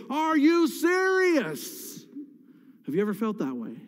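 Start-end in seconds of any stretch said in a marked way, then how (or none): 0.1-2.1 very high, drawn-out disbelief; incredulous to God. Retold or imitated. Pastor voicing his right to question God, very high and slow disbelief. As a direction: stretch it slowly, as if God can't mean it. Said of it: "permission before God to say".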